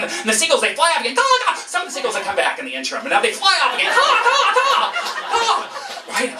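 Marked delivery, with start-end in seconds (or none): 1.2-1.5 high squawking caw caw; seagull caws. Retold or imitated. Teller imitating the seagulls, high squawks. 3.9-5.6 high squawking caw caw; seagull caws. Retold or imitated. Teller imitating the seagulls, high squawks.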